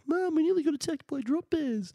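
put-on high, breathless child telling his mum the news; little boy bursting with news for mum; excited kid.

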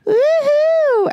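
high, drawn-out "woo-hoo"; long high celebratory whoop; cheering whoop. Real, not acted. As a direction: whoop it high to open the show. Whooping as the show opens.

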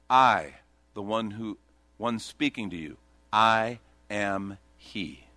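slow, low, weighty declaration; solemn declaration. Acted. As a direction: draw each word out low, with pauses. Jesus declares who he is.

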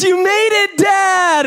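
put-on high, loud little-girl cheer; two-year-old daughter cheering. Toddler daughter cheering dad down the stairs, high shout. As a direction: squeal it out like a proud toddler. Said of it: "She goes, she goes".

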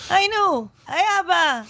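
high-pitched exclamation in a joke; acted joke punchline. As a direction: call it out high, acting the part.

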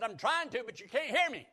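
high, loud acted answer. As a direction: strain to get through to someone deaf. Acts out God answering over deafness.

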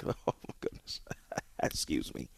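choking and gasping through laughter; fighting a coughing fit while laughing; choking on a drink. Real, not acted. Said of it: "I'm, I'm choking".

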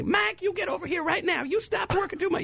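high squeaky nagging voice; rat witch voice. Retold or imitated. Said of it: "I do this impression".

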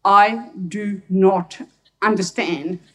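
very slow, word-by-word English; painstaking schoolbook English; best careful English. Retold or imitated. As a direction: spell out each word like a learner. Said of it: "in my best English".

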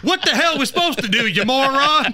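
a light Stork voice, bewildered; lightly voiced Stork asking what to do; Stork impression. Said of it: "She was also Stork in the movie Animal House".

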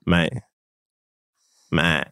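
Aussie "mate", flat broad vowel; Australian accent. Shown as a demonstration. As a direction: drop pitch, say mate like an Australian. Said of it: "Say mate".

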